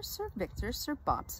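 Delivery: usual speaking voice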